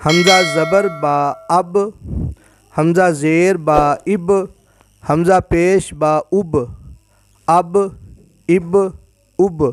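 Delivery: slow drill, spelling hamza, vowel and با, then joining as ab, ib, ub; each اب one joined syllable with a sounded final b, vowels a, i, u in turn; joined jazm reading. Shown as a demonstration. Teacher shows how jazm letters are read joined, slow spelling drill. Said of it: "ملا کے پڑھتے ہیں".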